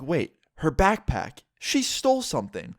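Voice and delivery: slow, then jumping way up in pitch on "she stole"; the penny drops, mid-thought; sudden realization. Retold or imitated. Realizing his girlfriend stole something, stunned and rising.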